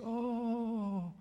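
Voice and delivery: cat lady's high, thrilled little "Oh!"; delighted gasp. Retold or imitated. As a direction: gush with sudden delight. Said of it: "She goes".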